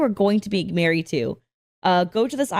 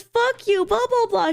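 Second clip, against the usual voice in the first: mock angry girlfriend; 12 semitones higher than usual. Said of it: "she goes".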